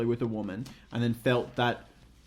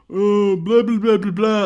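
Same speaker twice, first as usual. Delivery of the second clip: loud, higher-pitched mock whining babble; kids' babble; 6 semitones higher, 8 dB louder than usual. Imitating confused kids, loud whiny babble. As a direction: babble like a rowdy pack of kids. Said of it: "just the way that kids speak".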